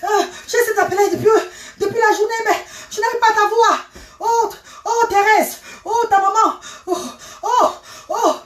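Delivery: high-pitched laughing greeting on the phone; a relieved uncle laughing as he picks up. Retold or imitated. (oncle qui rit fort au téléphone, voix haute)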